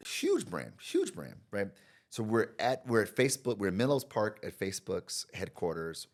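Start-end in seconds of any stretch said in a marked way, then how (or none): 0.1-1.4 much higher emphatic huge brand; emphatic repeat. Real, not acted. Agreeing the client is a big brand, high emphatic stress. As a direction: pitch huge up high both times. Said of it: "repeated for emphasis".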